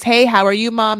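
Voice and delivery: put-on upbeat hello to Mom; hearty greeting. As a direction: greet your mom loudly and cheerily. Models greeting mom on call.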